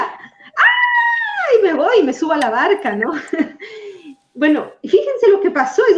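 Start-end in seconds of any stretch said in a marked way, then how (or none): 0.0-1.6 sudden high shriek of fright; playful frightened shriek; mock scream. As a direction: yelp it like you just saw something terrifying. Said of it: "yo gritaría".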